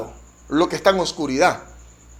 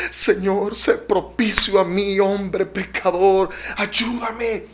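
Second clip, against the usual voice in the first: a touch of pleading, drawn out slowly; preacher voicing the sinner's tearful prayer, slow and pleading; tearful plea; 3 semitones higher, much slower than usual. Preacher voicing the sinner begging God for mercy, mildly tearful and slow. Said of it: "el otro lloraba y decía".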